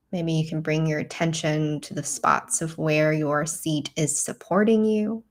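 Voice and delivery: calm, measured meditation voice with long pauses; guided meditation. Real, not acted. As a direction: guide them gently inward, leaving space to breathe.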